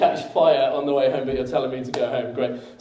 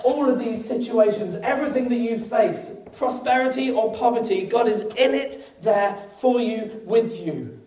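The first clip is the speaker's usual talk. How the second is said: high, fervent preaching; 7 semitones higher than usual. Acted. Pastor assuring the church of God, fervent preaching. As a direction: carry the congregation with conviction.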